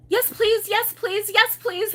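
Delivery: put-on high, loud eager child voice; excited little girl. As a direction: chant it like an eager toddler. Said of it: "so Annabelle says".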